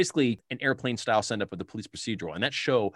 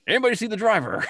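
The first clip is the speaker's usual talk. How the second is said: high, loud mock-innocent question; Drebin deadpan; 12 semitones higher, 6 dB louder, faster than usual. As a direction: ask as if nothing happened. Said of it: "And he's like".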